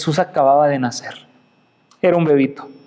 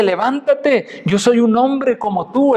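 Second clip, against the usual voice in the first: higher-pitched, mildly urgent quoted order; acted urgent command; 8 semitones higher, slower than usual. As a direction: order him up as Peter would, stretching the first word. Pedro detiene al que lo adora. (orden apremiante, imitando a Pedro)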